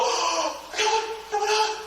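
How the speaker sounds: high, slow gleeful squeal, half-joking; mock excited. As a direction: mimic the overexcited rookie. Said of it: "when you're about to go".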